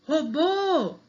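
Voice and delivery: ROBÔ with a high, stretched BÔ; calling the word. Shown as a demonstration. Teacher demonstrating final stress, calling roBÔÔ. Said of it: "o bo é a sílaba mais forte".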